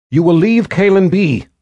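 put-on high, loud girl's demand; insist, high and loud; defiant girl. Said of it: "Gillian said".

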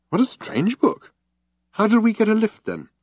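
lightly voiced bewildered Arthur; Arthur's voice. A narrator's reading. Arthur's voice in narration.

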